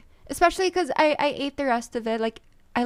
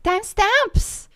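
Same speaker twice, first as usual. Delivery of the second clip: incredulous; 7 semitones higher, 7 dB louder, slower than usual. Real, not acted. Incredulous at burp comments.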